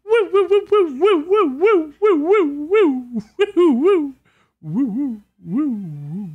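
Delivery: excited woo. Real, not acted. Celebratory woo chant.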